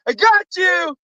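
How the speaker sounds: very high, gleeful-style prank reveal; hammed-up squeal of a prankster; mock gotcha. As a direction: pop up with a high-pitched surprise. Prankster jumps up to surprise friend.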